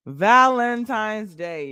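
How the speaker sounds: loud, high, slowly stretched VALENTINE'S DAY; drawn-out exclamation. Real, not acted. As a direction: belt out Valentine's Day, dragging it out.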